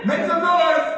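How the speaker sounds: huge, high, drawn-out yell; hype shout. Pretend. Speaker whips up audience for Eric Thomas, roaring shout. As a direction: bellow it like a stage hype man.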